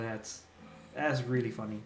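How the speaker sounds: ordinary talk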